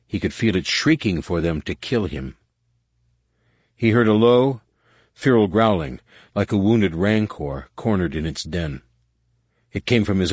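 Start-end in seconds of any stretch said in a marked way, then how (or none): none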